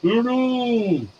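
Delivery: high, thin mock hello through a phone; imitating a muffled phone. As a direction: copy a tinny, distant caller. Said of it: "you sound like this".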